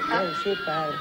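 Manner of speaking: put-on very high squeal of the boy; son squealing. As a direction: squeak it like a startled kid. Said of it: "¿Estás anotando?".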